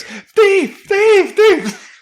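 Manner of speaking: high, loud repeated cry of thief; mock accusation. Dad pretending to accuse the little kiss thief, squealing. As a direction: shriek it like a cartoon accuser.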